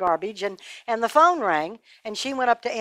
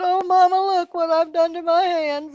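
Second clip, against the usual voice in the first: put-on little boy showing mama his cut hands; whining little boy; 9 semitones higher, 4 dB louder than usual. A narrator's reading. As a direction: complain like a hurt little boy. Tommy shows mama his hands.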